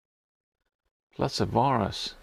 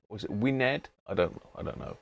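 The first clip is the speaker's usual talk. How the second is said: ordinary talk, nothing marked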